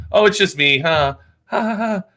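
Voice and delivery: a hint of a self-pitying wife; Debbie Downer. Overlooked wife announcing herself, lightly mimicked letdown. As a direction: deflate into a forced little laugh. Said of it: "She's like Debbie Downer".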